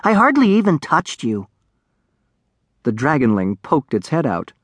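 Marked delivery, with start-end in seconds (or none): 0.0-1.5 higher-pitched, cajoling protest; coaxing. A narrator's reading. Darek to the dragonling he kicked, coaxing. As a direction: coax with a lifted voice. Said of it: "Darek coaxed".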